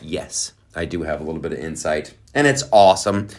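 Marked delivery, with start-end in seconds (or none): 0.0-2.1 quiet, fast, breathy-free hush; hushed put-on voice. Host answering himself in a hushed put-on voice. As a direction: drop into a secret hushed aside. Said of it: "I'm using this voice".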